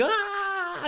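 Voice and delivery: a high, drawn-out melodramatic "Ah"; mock dramatic cry. Mocking the film's overlong anguished shot with a high cry. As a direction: overplay the agonised cry of a movie hero. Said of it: "a shot of a character for just a little bit too long being like".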